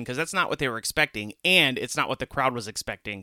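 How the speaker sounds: usual speaking voice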